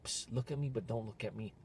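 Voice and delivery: soft secretive come-on; sly hushed whisper. Retold or imitated. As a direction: whisper it like a pickup signal. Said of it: "They're like".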